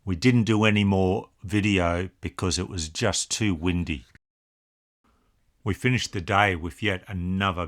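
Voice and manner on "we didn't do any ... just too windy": ordinary talk, nothing marked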